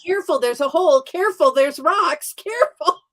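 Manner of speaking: high-pitched, acted-out cautioning; hammed-up fretful heads-up; mock anxious warning. Acts out her warnings to Tony.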